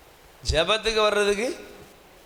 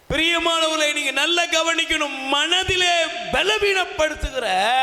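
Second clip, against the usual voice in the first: fervent sermon exhortation; impassioned preaching; 6 semitones higher than usual. Real, not acted. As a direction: preach it high, stretching the key words. Preaches at raised pitch.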